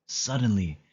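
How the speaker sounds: plain reading voice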